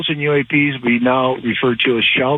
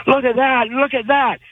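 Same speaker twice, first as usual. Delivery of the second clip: excited high-pitched quoted shout; retold scream; 9 semitones higher than usual. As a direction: imitate a startled workmate's cry, pitched high. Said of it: "just started screaming".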